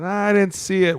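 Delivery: high, whiny mock of a ref's excuse; mock the ref's lame excuse. Player mocking the ref's excuse, high and whiny. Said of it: "I hate how they say that".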